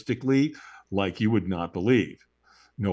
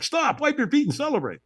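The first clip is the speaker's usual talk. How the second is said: much higher, bright calling out; catchers' call; 13 semitones higher than usual. Retold or imitated. Men at the lane's end calling, much higher. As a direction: cheer him to a stop. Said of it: "they're like".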